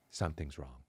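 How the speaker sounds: very soft, low and ominous; imitating a worried wife, barely audible; hushed wife. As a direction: drop to a hushed, low report of bad news. Wife's worried words retold.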